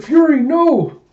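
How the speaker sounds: very high, loud cry of alarm; acted panicked shout; desperate scream. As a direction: scream to stop a pet leaping into danger. Koyomi screams as Fury leaps.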